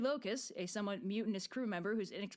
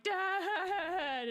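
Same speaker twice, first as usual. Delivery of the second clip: excited cry; 9 semitones higher, 5 dB louder than usual. Retold or imitated. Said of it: "And Telemachus is like".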